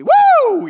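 loud, high whoop; preacher cheering Paul on, very loud and high; mock cheer woo. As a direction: whoop like a fan in the stands. Mock cheering Paul's brag.

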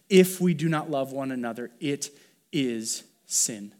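"it is sin" slowed right down, word by word; emphatic and clear. Real, not acted. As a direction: spell out "it is sin" with deliberate gaps between words. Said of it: "say it emphatically and as clearly as possible".

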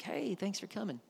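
a sarcastic picture of an unbothered Martha; breezy polite host. Pretend. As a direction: play it mockingly nonchalant. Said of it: "I cannot imagine Mary and Martha being like".

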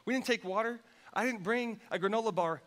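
normal talking voice

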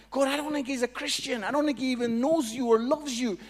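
loud, high-pitched objection; pleading protest. Retold or imitated. Speaker argues with God about the guy, loud protest.

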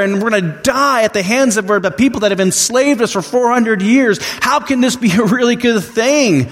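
high, fast, panicky complaining; complaining Israelites. Retold or imitated. Israelites in the desert complaining to Moses, high and fast. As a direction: whine at Moses as the frightened crowd. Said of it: "they start complaining to Moses".

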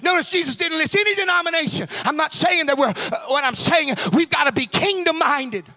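loud, rising preacher's cry; preaching at full force. Acted. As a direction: drive it home to the congregation.

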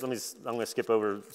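ordinary talk, nothing marked

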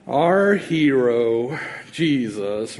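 slow, low mopey drawl; lightly voiced gloomy Eeyore. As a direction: mope it out like Eeyore. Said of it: "an Eeyore tone of voice".

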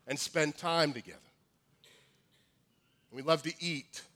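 normal talking voice

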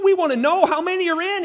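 high, loud, hurried clamour; insistent demand. Acted. Crowd demanding numbers from Jesus, high and insistent.

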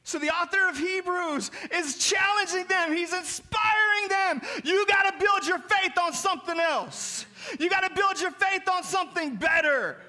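impassioned pulpit preaching; preaching at full force. Acted. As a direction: drive the point home to the church.

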